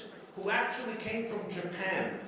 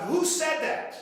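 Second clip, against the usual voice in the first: higher, louder, indignant demand; a startled teacher's raised shout; mock shout; 7 semitones higher, 4 dB louder than usual. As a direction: bark the question at the class like an outraged teacher. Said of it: "The teacher screams out".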